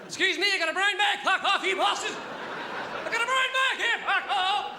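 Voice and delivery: high, loud Northern Irish yelling at passengers; Northern Irish shouting. Retold or imitated. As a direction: bellow in a thick Northern Irish accent, chasing the guard. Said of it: "Northern Irish accent".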